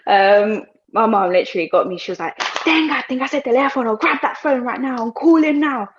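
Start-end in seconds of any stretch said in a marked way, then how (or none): 2.4-5.9 mum's stern order. Retold or imitated. Mum orders her to call.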